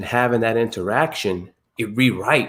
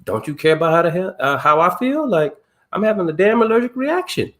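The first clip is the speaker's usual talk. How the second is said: snapping at son; 7 semitones higher than usual. Retold or imitated. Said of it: "I was like".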